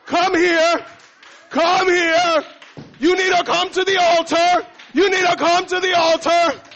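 high, loud commanding call to the altar; insistent shouting. Retold or imitated. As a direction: summon him forward at full volume. Church women calling him out.

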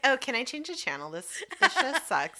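high-pitched imitation of a pushy houseguest; mock complaint. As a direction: complain like you own the couch. Acting out the intrusive houseguest.

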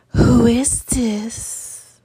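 slow breathy whispered intro, drawn out; husky whisper. Pretend. Playing to her podcast listeners, a slow husky whisper. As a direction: whisper it like a late-night radio host.

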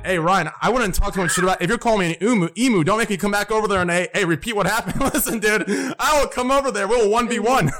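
high-pitched joking warning, breaking into laughs; mock threat. Streamer jokingly challenges a friend over emu teasing, mock threat.

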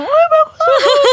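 very high, loud squeal of delight with laughter; happy cheering. Retold or imitated. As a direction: re-enact a group gushing happily, voice shooting up. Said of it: "kita yang kayak happy bareng-bareng". (sorak gembira melengking sambil tertawa)

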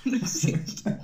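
breathy giggle through the words; laughing while talking. Real, not acted. Jokes about not existing.

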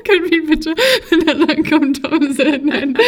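high, giggly request; laughing plea. Real, not acted. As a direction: beg through giggles. Pleads while cracking up.

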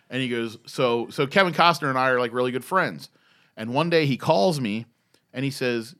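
usual speaking voice

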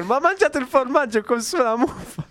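high, scandalized grandmother voice. Retold or imitated. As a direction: pitch it up, shocked and appalled. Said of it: "tornata a casa scandalizzatissima".

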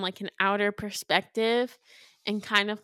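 ordinary talk, nothing marked

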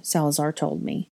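plain reading voice

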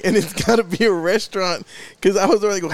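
usual speaking voice

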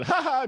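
high little mock laugh; mock giggle. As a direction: giggle like a kid sister. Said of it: "she'd start laughing".

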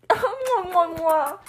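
loud high kissy smacks; kiss sounds. Real, not acted. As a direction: blow kisses. Blowing kisses.

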